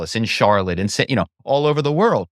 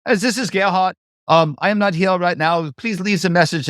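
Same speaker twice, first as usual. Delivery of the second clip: put-on German accent; 7 semitones higher than usual. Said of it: "so that I could imitate their voice".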